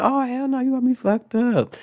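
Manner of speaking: high-pitched acted-out indignation; outraged protest. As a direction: exclaim it high, in mock outrage.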